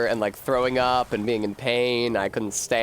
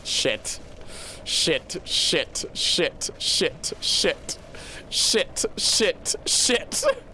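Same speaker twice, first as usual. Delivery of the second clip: whispered curses climbing higher; escalating swearing; 3 semitones higher, much slower than usual. Real, not acted. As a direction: hiss it out, rising in panic. Frustrated repeated cursing, building up.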